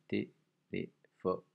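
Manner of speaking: "Téléphone" very slow, syllables separated. Shown as a demonstration. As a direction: stretch out "Téléphone" to count its syllables. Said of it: "Quatre syllabes".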